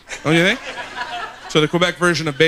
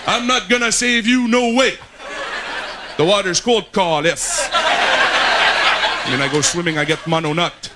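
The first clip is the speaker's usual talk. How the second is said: put-on higher, heavy Québécois lifeguard accent; Quebec French accent; 5 semitones higher than usual. Quebec lifeguard warning an English swimmer, Quebec accent. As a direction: warn him off in a thick Quebec accent.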